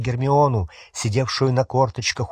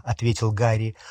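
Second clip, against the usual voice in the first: ordinary delivery, nothing marked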